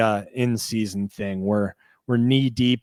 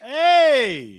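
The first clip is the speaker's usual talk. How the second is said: excited shout; 9 dB louder than usual. Real, not acted. Sudden excited interjection.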